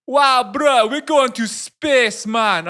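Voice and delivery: high, loud, drawn-out Jamaican impression; booming exaggerated Jamaican accent, very high; mock Jamaican accent. Friend hyping a spaceship doodle, mock Jamaican accent. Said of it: "he's not even Jamaican".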